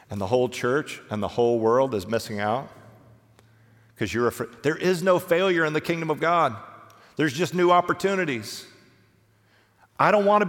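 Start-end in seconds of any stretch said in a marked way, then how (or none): none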